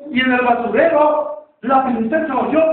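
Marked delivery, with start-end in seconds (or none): none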